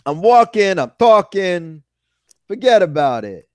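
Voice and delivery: slow, exaggerated New York vowels; mock New Yorker, playing along; New York accent. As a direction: ham up a New York accent, loud then easing off. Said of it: "You sound so New York".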